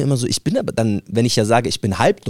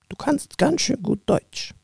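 grandpa quizzing; 9 semitones higher, 6 dB quieter than usual. Retold or imitated. Stern grandfather questions guest.

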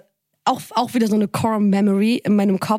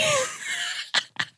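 high burst of laughter; 8 dB quieter than usual. Real, not acted. Laughing at her own retold phone call, high and bursting. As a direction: laugh jumping high and breaking off.

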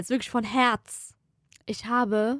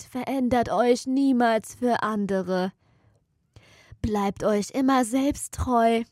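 slow solemn; slower than usual. Pretend. Mock motivational closing phrases.

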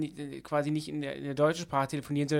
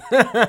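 loud real laugh; laughing hard; burst of laughter; 7 semitones higher, 11 dB louder than usual. Real, not acted. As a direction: crack up at the punchline. Laughing at his own sketch quote.

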